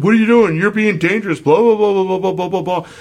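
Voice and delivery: high, loud mocking yell of the cyclist; imitating an angry cyclist. Cyclist yelling at him at the light, high loud mock rant. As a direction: shout it like the furious cyclist, mocking them. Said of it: "they started yelling at me".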